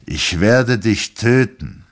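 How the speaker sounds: husband threatens his wife, slow and loud; very slowly. A narrator's reading. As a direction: drag out the threat word by word. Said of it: "sagte er ganz langsam".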